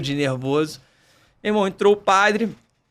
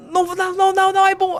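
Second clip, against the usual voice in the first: high protesting bride; 12 semitones higher, faster than usual. Retold or imitated.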